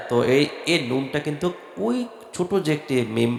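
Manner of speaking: ordinary talk, nothing marked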